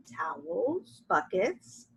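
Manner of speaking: usual speaking voice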